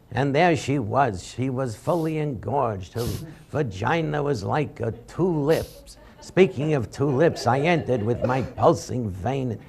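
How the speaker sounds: slow, soft, deadpan announcer read; lightly voiced sportscaster reading smut; Howard Cosell reading. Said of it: "I started doing Howard Cosell reading these letters".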